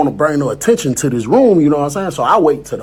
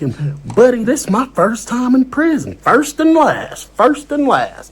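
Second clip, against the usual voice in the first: put-on higher Southern country accent, slowing on "First and last"; imitated country accent; 8 semitones higher than usual. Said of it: "The accent, bro, that was good".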